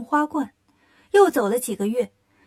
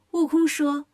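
ordinary delivery

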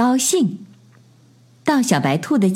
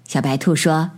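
ordinary delivery

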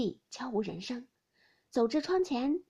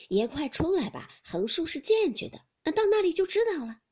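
hurried, rising servant's plea; impatient coaxing, getting higher and quicker; urging; 7 semitones higher, 6 dB louder than usual. A narrator's reading. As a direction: hustle the master along. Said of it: "一面催着宝玉".

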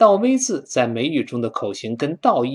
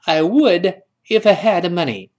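louder, higher, punched "would"; emphatic linked reading with "would" on top; model repeat reading; 4 semitones higher, 5 dB louder than usual. Shown as a demonstration. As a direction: lead the class through it, stressing "would". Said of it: "再来一遍".

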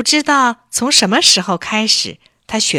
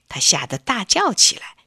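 plain reading voice

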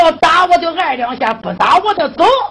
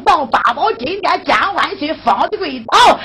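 ordinary delivery

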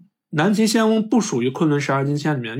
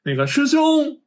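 calling out from behind; 11 semitones higher, slower than usual. Retold or imitated. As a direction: call after him to make him turn around. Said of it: "然后在后面喊". (高声远远地喊)

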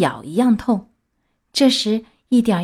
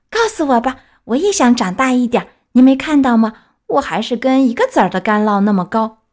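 put-on raised, eager child puppet voice; pleading Pinocchio; 3 semitones higher, 4 dB louder than usual. Puppet begs fairy for the secret of growing, pleading. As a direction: coax like an impatient little boy.

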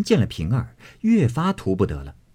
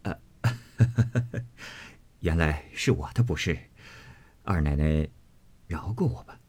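soft, low, coaxing apology; husband bowing to apologize to his wife; sheepish laugh; 3 semitones lower, 7 dB quieter than usual. A narrator's reading. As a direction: laugh it off and beg her pardon. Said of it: "笑道".

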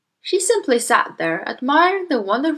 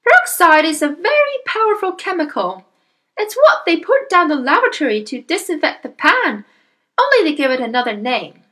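high-pitched lecturing wife; mother in the story; 8 semitones higher, 4 dB louder than usual. Acted. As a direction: lecture your husband in a high voice. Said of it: "the mother said".

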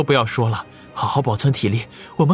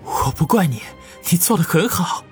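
gentle; 9 semitones higher than usual. Acted. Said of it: "柔声地说道".

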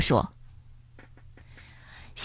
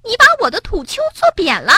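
much higher, grumpy little mole snapping back; irritated mole whose mound was flattened, snapping; cross mole; 13 semitones higher, 3 dB louder, much faster than usual. Acted. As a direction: snap at him like an annoyed small creature. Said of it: "小鼹鼠生气地说".

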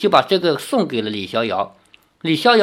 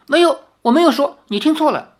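put-on fast, high child backpedalling; a kid covering a slip of the tongue; rushed, high little boy denial; hasty denial; 10 semitones higher, 4 dB louder, faster than usual. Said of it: "那小孩连忙捂着嘴".

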